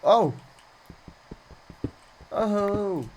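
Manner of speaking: sharp startled oh; surprised gasp. Real, not acted. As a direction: caught off guard by something. Sudden discovery while mining.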